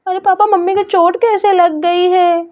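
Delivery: put-on worried little girl calling for mummy. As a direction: play a small girl looking for her mother. Child [name] speaks.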